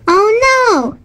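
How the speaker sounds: high-pitched storybook cry of alarm; mild alarmed shout. A narrator's reading. Woman finds the Easter bunny missing, mild alarmed shout. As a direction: call out in alarm, keeping the volume modest. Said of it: "shouted a woman".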